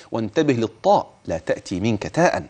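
ordinary talk

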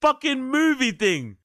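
high, loud incredulous wail; exasperated. Real, not acted. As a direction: cry out at how absurd it is. Exasperated at the cheesy story.

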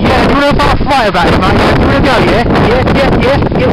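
loud, goading pub challenge; mock fight challenge. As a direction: square up and shout.

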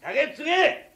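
loud, high barked order; drunk demand. Acted. Drunk guest bellows at the courtesan for sake, loud. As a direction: slam the order down.

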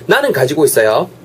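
ordinary talk

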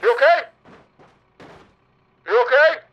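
high, loud, fake-worried squeal; squeaky mock concern. Host mocking the crashed driver in high, loud fake concern. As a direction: gasp over him in a cartoonish high voice.

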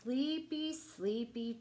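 ordinary delivery

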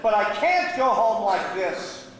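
slow, forceful self-reproach; emphatic refusal. Acted. Gambler refusing to return broke, emphatically. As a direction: drive it home, slowly and firmly. Same thought repeated with force.

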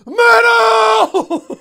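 very high, loud rock shout. Pretend. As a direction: scream it like a metal fan. Shouted joke.

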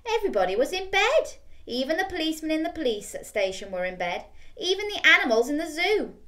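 usual speaking voice

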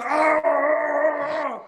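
a short high pained yell; high mock scream. Teammate after the elbow, a high pained yell. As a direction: yelp like you just took an elbow.

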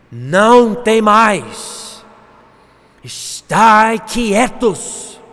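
loud, very slow, stretched command; commanding proclamation. Acted. Moisés telling the frightened people to be still, commanding. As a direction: proclaim it with long, ringing vowels.

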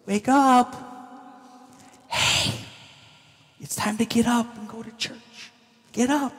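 tender, near-whispered wake-up call; gently waking kids. Acted. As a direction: softly rouse a sleeping child. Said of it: "not like how we wake up our kids".

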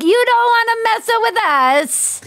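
high-pitched hammed-up Italian mobster; bad Italian mafia accent. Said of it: "This is my bad Italian mafia accent".

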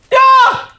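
high triumphant yelp; excited cheer. Real, not acted. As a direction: shout it in victory. Gaming success cheer.

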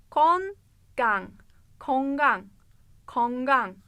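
Kongang said slowly in Korean, round o sounds; teacher models Kongang for the student; Korean pronunciation. Shown as a demonstration. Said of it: "Kongang ne demek?".

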